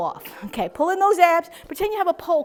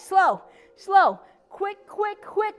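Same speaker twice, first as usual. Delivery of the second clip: rhythmic workout count; 5 semitones higher, much slower than usual. Shown as a demonstration. Instructor with four more sets to go, rhythmic cadence. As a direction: call two long SLOWs, then three short QUICKs. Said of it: "we're gonna do quick".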